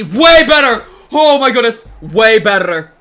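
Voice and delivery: high, loud thrilled shout. Real, not acted. Streamer to chat, shouting happily at the better deal. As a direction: shout with relief and delight.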